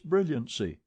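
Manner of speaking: plain reading voice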